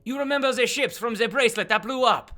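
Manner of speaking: high, loud insistence; exasperated character voice. Acted. Duke reminding confused companion of the ships, loud exasperation. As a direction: press the reminder, pitched well up.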